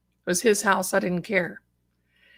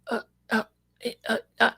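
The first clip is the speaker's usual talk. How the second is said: imitating helpless stammered grunts after a stroke; straining to get words out but only uh comes; unable to speak; 3 semitones higher, 3 dB quieter, much faster than usual. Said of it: "And I couldn't talk".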